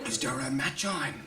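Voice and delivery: dreamy. Acted. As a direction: sigh the word like it's a treat. Son dreamily hears of telly.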